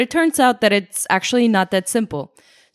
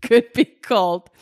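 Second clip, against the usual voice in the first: grand proclamation; 3 semitones higher, much slower than usual. Pretend. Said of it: "You didn't say that".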